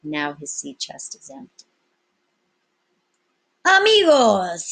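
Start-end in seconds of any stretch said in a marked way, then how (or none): 3.6-4.7 loud, slow hail across the deck; calling out. Acted. Captain calling his pirate crew, loud and slow. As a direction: call the crew together, drawn out. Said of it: "he called out".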